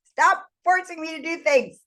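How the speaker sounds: high, mock-upset objection; retold protest. Acted protest in the retold fight.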